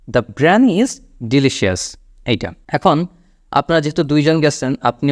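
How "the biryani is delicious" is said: very slow, falling at the end; the clean correct line, drawn out; slow correct example. Shown as a demonstration. Teacher giving the correct short sentence, slowly. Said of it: "Just simply".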